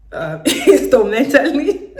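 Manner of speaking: slow, high, laughing through the word. Real, not acted. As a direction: giggle out the silly word. Asking to pay in installments.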